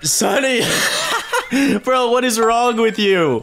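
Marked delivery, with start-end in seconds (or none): 0.7-1.8 high, squealing burst of laughter. Real, not acted. Laughing at his friend's confession, squealing laugh. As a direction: crack up in disbelief. Friend admits liking the fangirl.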